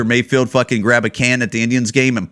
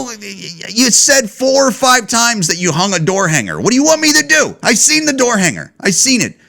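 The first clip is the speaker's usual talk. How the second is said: unload on him, fed up; telling off the rat bait worker, heated; heated outburst; 9 semitones higher than usual. Retold or imitated. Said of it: "that's not why I'm acting like an asshole".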